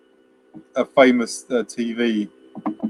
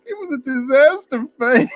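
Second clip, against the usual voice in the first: mock near tears; 13 semitones higher than usual. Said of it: "he's almost crying".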